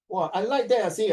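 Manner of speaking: ordinary delivery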